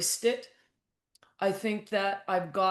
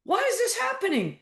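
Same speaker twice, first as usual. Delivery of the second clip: mock exasperated outburst; 12 semitones higher than usual. Coach recalling her younger self, mock frustrated outburst. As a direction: play your younger self throwing up your hands. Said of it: "Like, I was mad".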